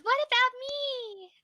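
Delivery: high, slow squeaky fairy. Acted. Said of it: "The fairy looks at you and goes".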